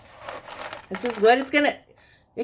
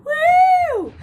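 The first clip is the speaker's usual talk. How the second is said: high cheering woo, much louder; excited woo; 7 dB louder than usual. Real, not acted. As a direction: whoop with delight. Excited cheer for finished craft.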